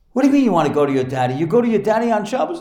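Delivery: quick, rising incredulous retort; exasperated mother. Retold or imitated. Mother questioning her crying son at bedtime, quick exasperated protest.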